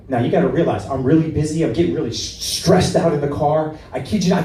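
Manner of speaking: ordinary talk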